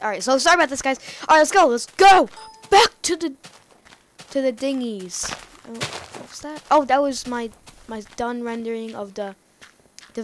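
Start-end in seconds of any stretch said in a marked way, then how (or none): 0.0-5.3 high, loud, pumped-up restart; excited burst. Real, not acted. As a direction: shake off the gloom, loud and eager. Snapping back to energetic play.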